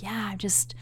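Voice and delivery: whispered, slow fake tiredness; faked exhaustion. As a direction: play it drained to get out of the date. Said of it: "put on my best acting chops".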